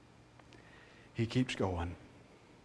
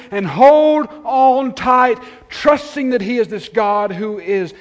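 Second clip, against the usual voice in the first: louder, higher, driving sermon delivery; impassioned preaching; 7 semitones higher, 8 dB louder than usual. Real, not acted. Preacher urging the congregation to hold fast, impassioned. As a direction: build to a forceful exhortation.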